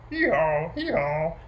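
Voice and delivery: loud two-note hee-haw; donkey braying. A narrator's reading. Storyteller voicing the donkey among the animals, braying. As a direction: bray like a donkey, twice. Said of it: "and the donkey braying".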